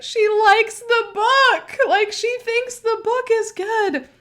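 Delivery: high, slow excited friend voice; a friend raving about the book, high and slow; voicing her friend. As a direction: gush like a thrilled reader.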